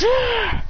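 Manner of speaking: a sudden high-pitched cry; high yelp. Retold or imitated. Villain hit by his own fire, high yelp. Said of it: "He goes".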